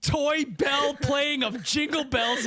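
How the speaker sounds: high, slow laughing shout; high slow laughing exclaim. Real, not acted. Laughing overstated complaint.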